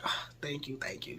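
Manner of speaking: soft whispered gratitude; whispered thanks. Retold or imitated. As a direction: whisper your thanks, pleased. Breathy thanks for compliments.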